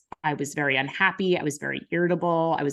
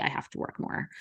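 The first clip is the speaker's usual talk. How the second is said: whispered; 9 dB quieter, faster than usual. Retold or imitated. Insisting to herself for sanity.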